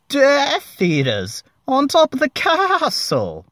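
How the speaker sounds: high falsetto bleat; squeaky alarmed cry, high and loud; panicked high bleat. A narrator's reading. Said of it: "Ron bleated, quivering".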